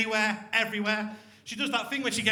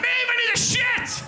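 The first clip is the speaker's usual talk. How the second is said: high, loud and slow, hammed-up urgency; hammed-up girlfriend bursting into the bathroom; urgent shout; 14 semitones higher, 8 dB louder than usual. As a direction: yell it through the door.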